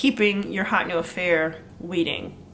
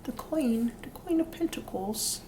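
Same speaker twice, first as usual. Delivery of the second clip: put-on whispered, higher British accent on Queen; slight British accent, whispered; 5 semitones higher, 5 dB quieter than usual. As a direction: breathe out Queen of Pentacles in a soft British accent. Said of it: "doing a little slight British accent".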